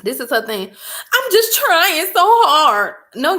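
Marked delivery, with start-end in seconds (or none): 0.7-3.0 high, slow whimper; whiny crying teen. Retold or imitated. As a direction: mimic the sobbing daughter. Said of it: "daughter's sobbing reply".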